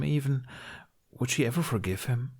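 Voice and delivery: ordinary delivery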